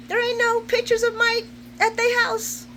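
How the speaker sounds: high-pitched disbelief; incredulous. Real, not acted. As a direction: repeat it in rising astonishment. Reacting: wait a second, no pictures.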